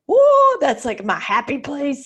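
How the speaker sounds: a soaring high whoa then a slow dreamy glow; blissed-out whoa. Retold or imitated. Telling a podcast host about a retreat high, soaring excited whoa. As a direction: relive the thrill of your happy place.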